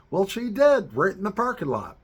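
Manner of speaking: high, loud punchline; gleeful punchline. Real, not acted. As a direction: land it with delight. Punchline of story.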